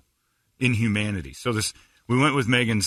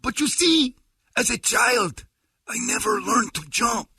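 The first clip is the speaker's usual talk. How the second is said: high, earnest explaining voice; husband voice; 3 dB louder than usual. Retold or imitated. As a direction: explain it with wounded pride. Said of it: "He's like".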